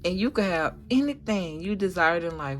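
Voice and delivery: ordinary talk, nothing marked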